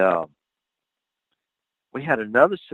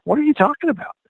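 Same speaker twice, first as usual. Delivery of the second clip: incredulous; faster than usual. Retold or imitated. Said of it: "And I'm like".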